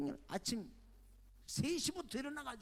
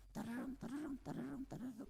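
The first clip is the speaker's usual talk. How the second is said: sing-song ringtone, pitched up; imitated phone ring; 6 semitones higher, 6 dB quieter than usual. Preacher imitating his phone ringing before the service, sing-song ringtone.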